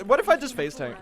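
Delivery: ordinary talk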